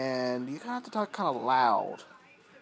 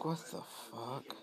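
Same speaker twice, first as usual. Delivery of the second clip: much softer, slowed baffled murmur; hushed disbelief; 11 dB quieter than usual. Real, not acted. Puzzling over voices nobody could make, hushed and slow. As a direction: say it under your breath, slow.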